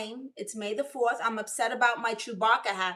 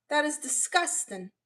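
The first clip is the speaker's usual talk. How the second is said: ordinary talk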